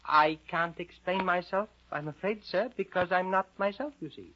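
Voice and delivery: put-on high, careful girl's voice; Alice's meek voice. Storyteller as Alice answering the caterpillar, meek and high. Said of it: "And Alice replied".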